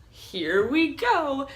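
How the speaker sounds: cheery stranger. Retold or imitated. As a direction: settle in happily. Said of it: "And then she's like".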